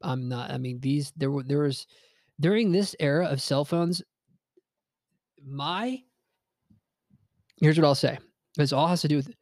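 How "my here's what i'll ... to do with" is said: speech broken by hiccups; hiccuping between words. Real, not acted. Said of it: "I have hiccups today".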